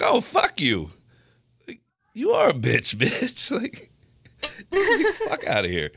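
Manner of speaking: loud, high, laughing mock outrage; retold blow-up breaking into a laugh; heated comeback. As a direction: tell her off, hammed up for the story. Retelling his angry comeback, laughing.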